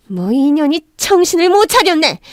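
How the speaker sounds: high, outraged rebuke; enraged yelling. A narrator's reading. Mother-in-law grabs daughter-in-law's hair, raging. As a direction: explode at the insolence. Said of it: "노발대발 소리를 지르며 난리를 부렸습니다".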